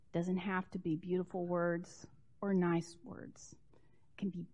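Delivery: soft whispered aside; hushed whisper. Real, not acted. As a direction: say it gently under the breath. Whispered in sermon.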